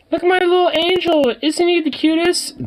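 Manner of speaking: very high, loud gushing squeal; doting parent voice. Acted. Parent showing off a demon-looking newborn, gushing falsetto. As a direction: coo over a baby in an exaggerated falsetto.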